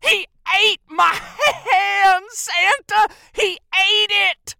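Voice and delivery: high, loud wail, drawn out at the end; hammed-up anguished shriek; hysterical elf. Maimed elf wails to Santa.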